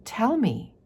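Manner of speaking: put-on a grave little girl pressing her mother; serious child. Pearl turning serious, pleading with her mother. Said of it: "she becomes very serious".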